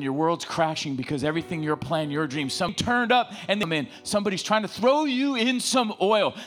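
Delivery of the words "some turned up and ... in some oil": higher-pitched, urgent preaching; rallying the congregation, voice raised high; fired-up preaching. Acted. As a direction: preach it with rising heat. Preacher building to full force.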